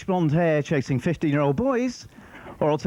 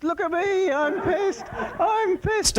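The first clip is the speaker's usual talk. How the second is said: high, giddy mock-drunk boast; drunk teenager; 13 semitones higher, much slower than usual. Comic mimicking his teen self after one swig, giddy. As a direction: crow it like a tipsy fifteen-year-old.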